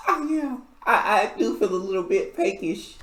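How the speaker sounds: put-on drunk childlike voice. Drunk Gabe answering.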